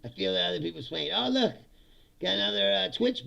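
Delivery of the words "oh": usual speaking voice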